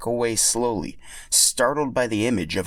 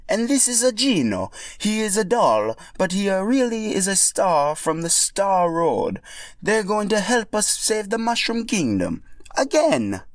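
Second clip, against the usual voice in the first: Mario voice; 11 semitones higher than usual. Acted. Mario introducing Mallow and Geno, high Italian accent. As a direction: introduce your friends with Italian gusto.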